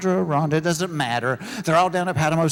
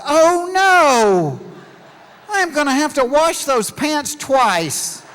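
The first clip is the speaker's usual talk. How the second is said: high, loud, slow dismay; disgusted wife; 7 semitones higher, 10 dB louder, much slower than usual. Retold or imitated. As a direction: groan it like she's already dreading the laundry. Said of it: "her first thought is".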